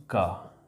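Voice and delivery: teacher models "का" as hard C; sound demo. Shown as a demonstration. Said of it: "तो इसको पढ़ते हैं".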